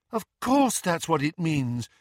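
high, cheerfully confident; bright. A narrator's reading. Luna reassuring Neville about the revolution, bright. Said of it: "said Luna brightly".

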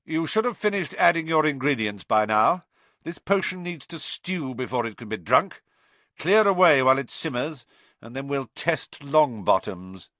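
high, projected teacher's orders; calling to the class. A narrator's reading. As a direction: call out instructions to the whole room. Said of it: "Snape called".

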